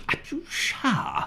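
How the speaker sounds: high, drawn-out mock-English exclamation; Terry Thomas. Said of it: "you've got Terry Thomas".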